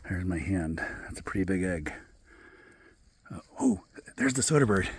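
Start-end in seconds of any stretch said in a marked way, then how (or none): 3.3-4.9 louder, higher startled alarm; suddenly panicked at the bird. Acted. Bird spotted near its egg.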